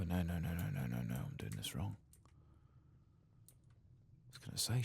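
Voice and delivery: usual speaking voice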